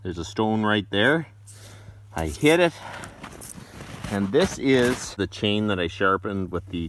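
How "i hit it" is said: ordinary talk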